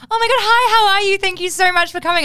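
high, loud, gushing thanks; comic greeting the crowd after a show, gushing; fake high after-show voice. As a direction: gush like a people pleaser. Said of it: "I don't fucking sound like that".

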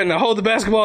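ordinary talk, nothing marked

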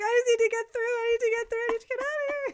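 high, loud frantic plea; desperate to escape, hammed up; mock panic. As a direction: replay your panic at full pitch. Retelling panicked push past clowns.

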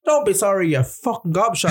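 put-on high, ranting old man; furious old man. Old man scolding a mattress dumper, high furious rant. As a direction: rant like an outraged old culchie at a litterer. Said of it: "and he goes".

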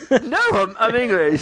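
high-pitched retold protest. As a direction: correct the mistake, pitched well up. Protests being taken for Dutch.

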